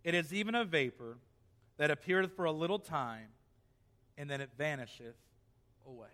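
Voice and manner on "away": usual speaking voice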